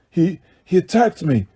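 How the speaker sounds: hint of a dazed young man; weakly mumbled, still stunned; dazed mutter. A narrator's reading. Injured student mumbling to headmaster, dazed mutter. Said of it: "Krum muttered".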